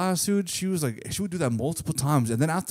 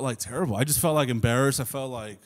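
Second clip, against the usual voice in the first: ordinary talk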